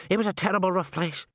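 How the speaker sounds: high-pitched, earnest character voice; Henry reminiscing. A narrator's reading. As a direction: recall a rough childhood home.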